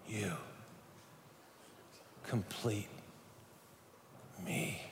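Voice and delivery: soft, very slow, overdramatic pathos; Tom Cruise. Acted. Speaker acts a movie love line for the audience, hammed-up Tom Cruise. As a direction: drag it out softly, pausing heavily between words. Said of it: "can anybody say it like Tom Cruise said it".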